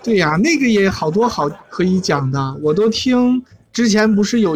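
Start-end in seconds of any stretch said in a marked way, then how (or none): none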